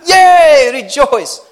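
loud, high sarcastic yay; mock cheer. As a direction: cheer like it's a party, tongue in cheek. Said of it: "sarcastic cheer".

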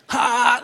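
a single sharp mock laugh; imitated loud laugh. Mimicking the kid next to Danielle, one loud laugh.

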